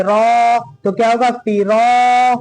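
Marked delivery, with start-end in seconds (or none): 0.0-0.6 "रा" held long; drawn-out vowel. Shown as a demonstration. Teacher demonstrating the rule, long held "रा". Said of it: "रा को खींचेंगे". 1.4-2.3 "फिरा" with quick "फि" and held "रा"; short then long. Shown as a demonstration. Teacher modelling the rule, long "रा" in "फिरा". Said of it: "रा को खींचेंगे".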